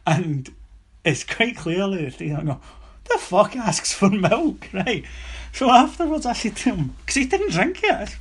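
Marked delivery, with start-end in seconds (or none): 3.1-4.7 incredulous mock aside. Board's imagined reaction to milk.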